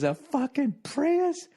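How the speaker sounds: high, drawn-out mock yell; high-pitched mock scornful heckle; imitated parting yell. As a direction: toss it out slowly in falsetto, like a parting insult. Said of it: "she threw her head back".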